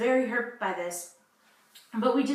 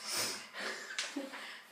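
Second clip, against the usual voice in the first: breathy giggle; 12 dB quieter than usual. Real, not acted. Laughs at own flub.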